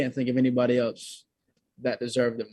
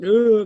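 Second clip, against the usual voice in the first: mock sob; 9 semitones higher, 10 dB louder than usual. Shown as a demonstration. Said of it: "not just like".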